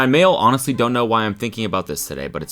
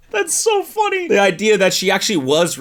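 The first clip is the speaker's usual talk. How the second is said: helpless laughing wheeze; cracking up; 12 semitones higher, 4 dB louder than usual. Real, not acted. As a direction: squeak it out through laughter. Cracking up at the story.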